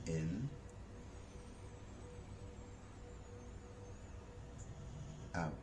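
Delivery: soft, slow guided breathing voice; soft spa whisper. Acted. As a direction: guide them gently through each breath. Leads a breathing exercise.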